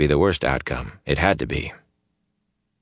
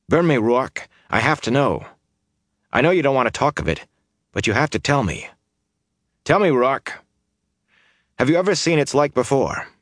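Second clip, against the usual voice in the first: urgent pleading; 7 semitones higher than usual. A narrator's reading. Rand presses Ruark for answers.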